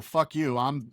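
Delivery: ordinary talk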